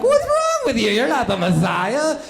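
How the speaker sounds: high mocking kids, laughing; mocking classmates. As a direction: jeer at him like a whole class of kids. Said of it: "the entire class was just like".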